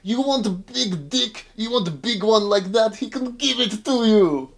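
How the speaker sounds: high, loud tipsy Russian coaxing; drunk mock Russian. Drunk Krichkov urging the Wishmaster to drink. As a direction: wheedle him like a drunk pal.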